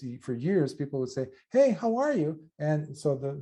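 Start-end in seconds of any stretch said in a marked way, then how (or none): 1.5-2.4 bright rising greeting pitched high; pitch going up. Retold or imitated. Imitating a Californian asking how are you, voice pitched up. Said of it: "the pitch goes up".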